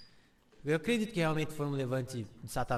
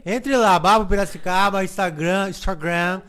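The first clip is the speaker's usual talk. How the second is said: upbeat sponsor plug, louder; ad read; 7 dB louder than usual. Acted. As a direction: sell it like a radio ad. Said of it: "Eu queria fazer um merchan aqui".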